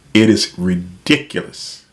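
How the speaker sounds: usual speaking voice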